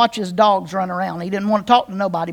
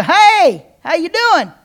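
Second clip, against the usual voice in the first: higher, louder bright hello to a stranger; cheery stranger greeting; 6 semitones higher, 4 dB louder, slower than usual. Retold or imitated. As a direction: call out a mock-friendly hi across the store.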